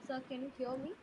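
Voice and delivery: very faint, distant voice; barely audible. Real, not acted. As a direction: speak barely above nothing. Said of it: "but your voice is very low".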